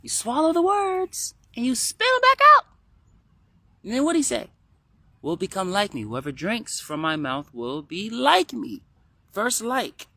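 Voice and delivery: put-on high child voice, pushed loud in places; kid voice. Kid explaining a secret saying to viewers, high child voice. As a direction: teach it like an emphatic little kid. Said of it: "you just did it in the kid voice".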